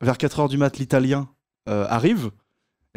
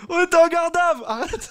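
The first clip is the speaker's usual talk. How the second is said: laughing disbelief. Real, not acted.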